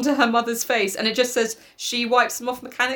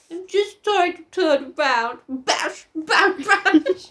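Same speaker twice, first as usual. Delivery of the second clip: slow, strained, higher mock voice; struggling creature; 7 semitones higher, much slower than usual. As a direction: grunt through a clumsy turn, comically. Voicing Gregor struggling to turn.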